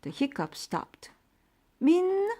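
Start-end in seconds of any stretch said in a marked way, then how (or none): 1.8-2.3 high, loud call; yelling. A narrator's reading. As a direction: call the name from afar. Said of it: "yelled Mom".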